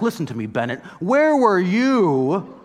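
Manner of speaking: mock indignant rant at a schoolmate, building to a high shout; mock yell at Bennett. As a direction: pretend to tell off a small child, building to a shout. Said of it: "I gotta go yell at Bennett".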